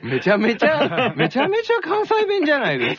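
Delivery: laughing protest. Real, not acted. Laughing at Kansai denial.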